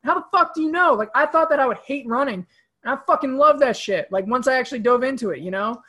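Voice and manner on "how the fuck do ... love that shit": high-pitched, heated mock challenge; exasperated rebuttal. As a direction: argue back in loud, playful disbelief. Acted rebuttal to a reluctant person.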